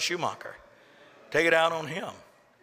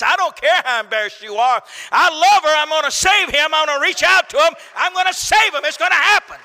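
much louder and higher, shouting love; passionate shout; 11 semitones higher, 9 dB louder than usual. Acted. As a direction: proclaim it full force as God. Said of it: "God says".

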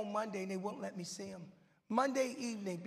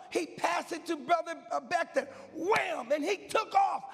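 higher and louder, hyped and rising; building preaching shout; 7 semitones higher, 6 dB louder than usual. Acted. Preacher telling the congregation his relay dream, hyped shouting. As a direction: preach it like the race is live.

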